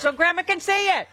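shouting up high for Grandma, playfully; hammed-up high yell; mock yelling. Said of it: "You scream on everything".

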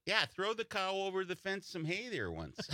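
high Wisconsin farmer voice; hammed-up Wisconsin farmer; Wisconsin accent. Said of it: "it's with German".

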